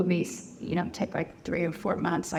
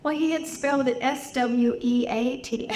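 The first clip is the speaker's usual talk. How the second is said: normal talking voice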